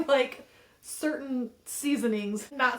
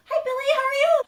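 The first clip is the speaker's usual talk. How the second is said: very high, louder sing-song coworker greetings; office voice; 6 dB louder than usual. Acted. Worker greeting colleagues on arrival, high office voice. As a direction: chirp small talk in a put-on polite pitch. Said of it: "audios walking into work".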